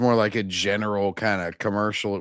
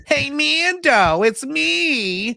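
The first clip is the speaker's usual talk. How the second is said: much higher, slow, cheery mechanic voice; mechanic greeting Mando; 13 semitones higher, slower than usual. Retold or imitated. Mocking a mechanic greeting Mando, in a high slow voice. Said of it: "and it's".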